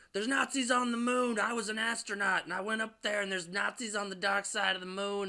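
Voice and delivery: high, wild street ranting; mock crazy yelling. A bum yelling at everybody, hammed-up crazed rant. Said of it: "he's yelling at everybody".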